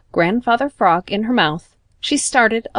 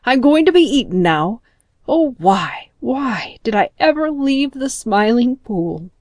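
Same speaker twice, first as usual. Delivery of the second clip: frightened lament; 5 semitones higher than usual. A narrator's reading. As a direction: wail as a frog about to be eaten. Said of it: "moaned Grandfather Frog".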